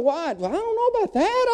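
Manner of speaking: high, startled what; mock-panicked balk; alarmed disbelief. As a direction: squeak up in alarm. Retells alarm at training.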